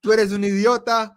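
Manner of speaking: a Spanish mom's loud rebuke. Retold or imitated. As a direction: scold him like an exasperated mom. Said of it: "in your Spanish voice".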